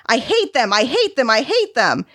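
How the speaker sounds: high-pitched mock tantrum at ordinary volume; screaming child, held back. A narrator's reading. Boy drowning out talk of his parents, mock screaming. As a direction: repeat it as an understated child's wail. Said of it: "from nonsense wailing to screaming".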